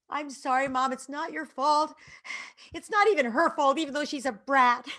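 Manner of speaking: high, loud mock-upset kid voice; imitated tearful child. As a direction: wail an apology like an overwrought child. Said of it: "and just sobbed".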